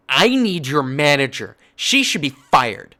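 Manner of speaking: loud, the last words slow and hard; imitated angry customer demanding; entitled demand. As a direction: demand the manager loudly. Angry lady demands the manager.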